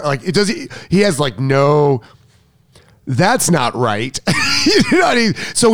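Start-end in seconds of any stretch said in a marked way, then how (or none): 4.7-5.7 high, fast words through a real laugh. Real, not acted. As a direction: laugh it out quickly. Laughing at her own story.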